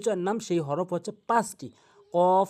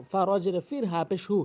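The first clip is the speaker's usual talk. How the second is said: ordinary delivery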